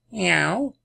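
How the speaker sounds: high rising meow; cat meow. Acted. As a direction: answer like a cat. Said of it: "gab der alte Tom zur Antwort".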